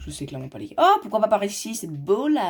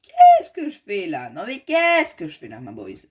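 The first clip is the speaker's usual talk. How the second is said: exaspération amusée; 3 semitones higher, 8 dB louder, slower than usual. Real, not acted. Se moque de sa propre construction.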